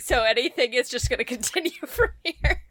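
real helpless laughter through speech; laughing to tears. Real, not acted. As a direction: talk while cracking up. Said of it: "I'm already crying".

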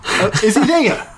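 lightly imitating a lost old man, rising into a laughing high shout; mock-bewildered pensioner calling for the voice, laughing; confused old man. Old man on Zoom calling to his wife, confused and rising. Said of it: "talking to his wife in the other room, going".

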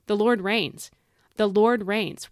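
"The Lord reigns" proclaimed loud and firm; bold declaration. Shown as a demonstration. Preacher showing listeners how to proclaim it, loud. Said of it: "We should say it like that".

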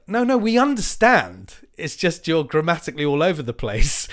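loud, high, smug retort; cheeky shout. Retold or imitated. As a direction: shout back a know-it-all correction. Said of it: "And I was like".

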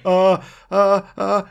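loud, high imitation of speechless "uh"s; mock flustered stammer. Footballer caught out by the star he impersonates, mock flustered stammering. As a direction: act out crumbling into helpless noises. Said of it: "Ali says he just crumbled and turned, and he's like".

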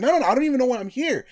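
high and fast, rattling out the denial; panicked protest. Retold or imitated. Held back at the door, pleading high and fast to leave. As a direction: rush it out, desperate to leave. People stopping him leaving.

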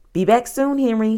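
chirpy, high send-off; cheerful. A narrator's reading. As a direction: call it out brightly and cheerily. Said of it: "she said cheerfully".

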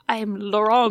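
put-on half-hearted French accent; bad French accent. Host imitating the movie vampire, bad French accent. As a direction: attempt a French introduction, badly. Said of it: "I can't do a French accent".

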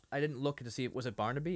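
normal talking voice

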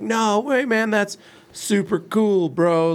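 lightly voiced old guy talking like a kid; a hint of a hip young dude; imitating a surfer dude. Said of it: "he's using new kid lingo".

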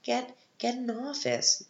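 high, incredulous protest at the movie; exasperated retort. Retold or imitated. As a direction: pitch it up in disbelief, like scolding the characters. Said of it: "And I'm like".

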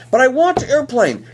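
put-on whiny child. Child voiced whining for airplane.

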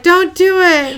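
loud, high, drawn-out mock plea; Steve's warning. Host voicing Steve warning Danny at the closet, loud mock alarm. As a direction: cry out a slow, hammed-up warning through laughter. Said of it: "Steve is like".

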